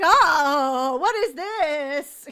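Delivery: slow, loud, baffled "what is this"; mock bewildered. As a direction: play the stunned onlooker. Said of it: "this guy's reaction".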